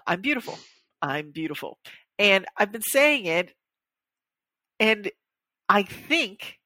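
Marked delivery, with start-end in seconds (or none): 0.1-1.8 half-hearted, sinking softer on the repeat; sarcastic affirmation. Acted. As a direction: recite it without believing it. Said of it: "like, sarcastic positive self-talk".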